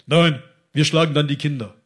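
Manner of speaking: loud, put-on threatening voice; acted threat. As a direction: play the defiant youth making threats.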